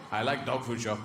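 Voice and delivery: low, contented dog voice; simple-minded dog. Acted. Guide dog musing about food.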